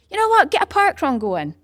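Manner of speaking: high, loud eager push; excited urging. Retold or imitated. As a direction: sell the idea with a burst of enthusiasm. Urging people to start parkrun.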